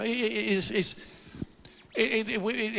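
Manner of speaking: ordinary talk, nothing marked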